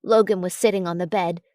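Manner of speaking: usual speaking voice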